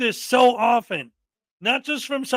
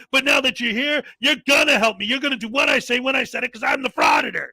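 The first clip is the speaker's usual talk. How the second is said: loud mocking tirade, fast in places; mock outraged rant; 2 semitones higher, 3 dB louder than usual. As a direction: ham up an entitled bully shouting down a worker. Acting out frauditor's rant at clerk.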